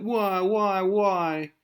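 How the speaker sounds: high, whiny mock wailing; mock crying. Dumped girl breaking down in the bathroom, mock crying. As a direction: bawl it in a put-on girlish voice. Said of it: "She starts crying".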